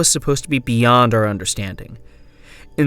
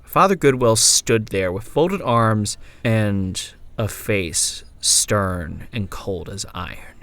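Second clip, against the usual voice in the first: plain reading voice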